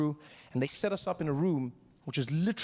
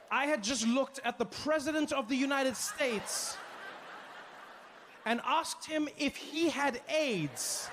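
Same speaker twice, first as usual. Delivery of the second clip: slow mortified; 9 semitones higher, slower than usual. Real, not acted. Mortified recollection.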